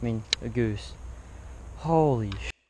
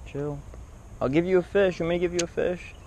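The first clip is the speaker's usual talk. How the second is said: higher, sing-song coaxing to the bird; coaxing goose; 5 semitones higher than usual. Real, not acted. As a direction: sweet-talk the goose back onto its eggs.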